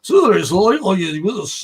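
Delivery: loud, garbled mock-Scottish babble; strong Scottish accent. Retelling a London chap nobody understood, thick mock Scottish accent. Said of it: "a chap with a very strong Scotch accent".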